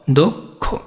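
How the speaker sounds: দোক খো split as "dok... kho"; syllable by syllable. Shown as a demonstration. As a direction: pause between দোক and খো. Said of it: "যেমন তোমার দোক". (ভেঙে "দোক... খো")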